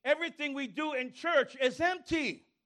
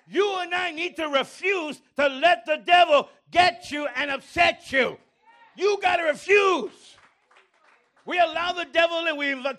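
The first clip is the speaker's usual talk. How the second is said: preaching shout; 5 semitones higher, 6 dB louder than usual. Acted. Preaching at full force.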